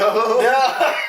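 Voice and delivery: loud, high refusal through laughter; laughing shout. Real, not acted. Refusing a friend's video suggestion, laughing shout.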